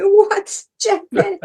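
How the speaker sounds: high gasping disbelief at the name; gasp, then say the name as if you can't believe it; stunned surprise. Retold or imitated. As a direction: starstruck gasp meeting Jack Benny. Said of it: "I'm going".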